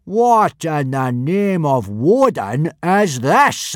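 furious. Acted. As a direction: spit out each word in outrage. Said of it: "pointing furiously".

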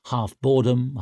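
ordinary delivery, nothing marked

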